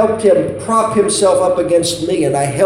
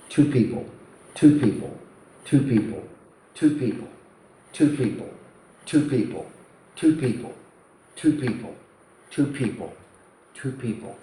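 repeated, dropping to almost nothing; fading count; 2 semitones lower, 7 dB quieter, faster than usual. Acted. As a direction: let each repetition die away further. Counting the dead by the second.

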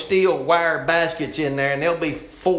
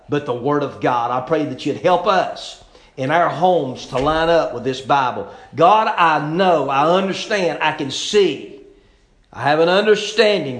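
earnest pastoral prayer, rising louder on the pleas; closing prayer; 5 dB louder than usual. Acted. Pastor prays over the ladies and their homes, earnest and pleading. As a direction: pray it out over the room, pleading.

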